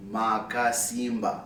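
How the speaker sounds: Makasimba said slowly, every vowel full; slow Shona word. Shown as a demonstration. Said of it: "so here's the word".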